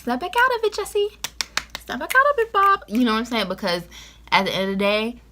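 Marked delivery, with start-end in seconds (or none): 0.0-2.8 high, sharp scolding voice; snap out of it. Retold or imitated. Telling seniors to scold themselves, high and sharp. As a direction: shake a friend awake with a high scold. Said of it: "You better be like".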